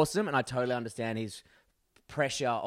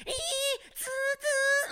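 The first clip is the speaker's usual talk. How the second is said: very high squealing car noise; imitated tyre screech; slower than usual. Passenger re-enacts the hairpin, high screeching noise. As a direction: squeal through the bend like skidding tyres.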